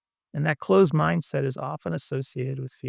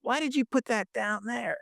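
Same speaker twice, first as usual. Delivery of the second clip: imitating a friend's annoyed, higher-pitched snap; mock the friend's irritated question; angry, annoyed; 7 semitones higher than usual. Imitating a judgmental friend complaining about the phone holder, annoyed higher tone. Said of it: "in the most angry, like, annoyed way".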